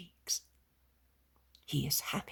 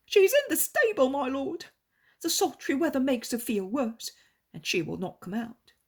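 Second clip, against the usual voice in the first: higher, louder desperate plea; pleading innkeeper; 9 semitones higher, 8 dB louder than usual. A narrator's reading. Innkeeper begs Jesus to heal his daughter, pleading. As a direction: beg the master for your daughter.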